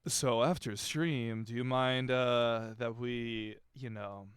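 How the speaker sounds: low, slow mock seductive voice; sultry Vanilla voice. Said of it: "every time Vanilla talks, it's like".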